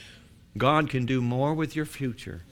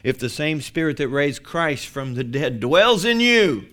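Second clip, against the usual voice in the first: loud preaching climbing to a shout; building proclamation; 3 semitones higher, 7 dB louder than usual. Acted. Preacher quoting scripture to the congregation, building to a shout. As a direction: build steadily, then shout the final words.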